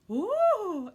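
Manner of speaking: startled high-pitched gasp; high shocked ooh. A narrator's reading. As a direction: shriek before fainting. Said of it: "answered Monsieur Dupont".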